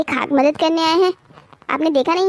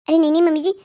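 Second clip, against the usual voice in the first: ordinary talk, nothing marked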